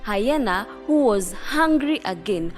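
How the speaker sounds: ordinary delivery, nothing marked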